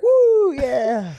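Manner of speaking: high, loud drawn-out cheer; mock victory whoop. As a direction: whoop like a champion alone in the ring. Acts fighter cheering alone in ring.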